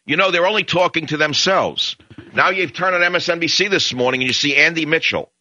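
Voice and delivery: hammed-up impersonation of a smug talker; mock pundit voice.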